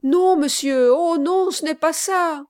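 high, loud, eager denial; urgent reassurance. Acted. Bride quickly reassuring the pale gentleman. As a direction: hurry to reassure him.

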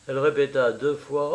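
usual speaking voice

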